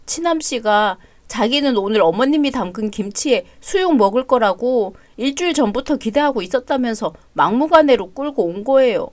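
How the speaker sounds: ordinary talk, nothing marked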